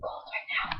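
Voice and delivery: breathy whisper of a character; soft, voiceless retold murmur. Girl in the book feeling cold, whispered.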